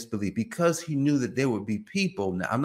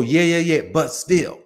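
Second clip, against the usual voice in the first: loud, higher dismissive yeahs; brushing someone off; 4 semitones higher, 7 dB louder than usual. Acted. Listener cutting off someone they are talking to, loudly dismissive. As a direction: cut in over them with impatient agreement. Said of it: "you kinda just brush them off".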